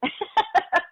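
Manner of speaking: high, loud burst of laughter; real loud laugh. Real, not acted.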